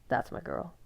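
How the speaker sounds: hushed, half-voiced murmur; a breathy aside to her; soft murmur. A narrator's reading. Jacob to Bella after she stomps, hushed.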